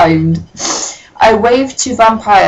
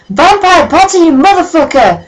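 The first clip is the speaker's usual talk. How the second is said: much higher, louder furious yell; 10 semitones higher, 5 dB louder than usual. Acted. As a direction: scream it across the classroom. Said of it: "I yelled".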